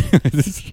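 squeaky little laugh; high-pitched giggle. Real, not acted. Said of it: "That sounds really high-pitched".

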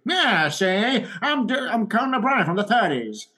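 high, mock gangster-style Yeah, see; a hint of an old-time detective; 1930s detective voice. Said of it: "his nineteen thirties detective voice".

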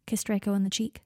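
ordinary delivery, nothing marked

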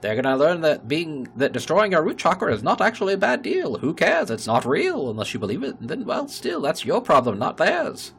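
high, loud barker's patter; carnival barker. Retold or imitated. Mocking the mayor as a loud carnival barker. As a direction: sell it like a sideshow barker. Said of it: "you sounded like a carnival barker".

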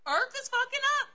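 squeaky high mock-incredulous repeat. Real, not acted.

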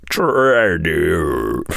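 "Tradio" heard as reversed sound; the played-back reversal of "Tradio"; reversed audio. Shown as a demonstration. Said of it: "the reversed sound".

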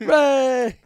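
high, loud mock cheer; crowd cheer. Demonstrating crowd cheer for goodies.

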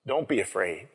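soft, gentle reassurance; quietly calm a frightened listener; spoken kindly. Acted. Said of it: "and spoke kindly to them".

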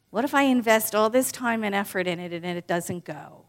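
ordinary talk, nothing marked

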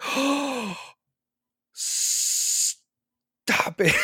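high gasp into a whispery rebuke; hammed-up scandalized reaction; mock-scandalized. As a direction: gasp and draw out the 'stop'. Crowd's reaction to Jesus, acted.

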